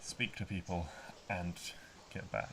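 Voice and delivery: ordinary talk, nothing marked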